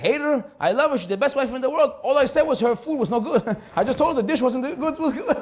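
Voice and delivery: a husband pleading he only meant the dish; protesting husband. Retold or imitated. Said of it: "He said".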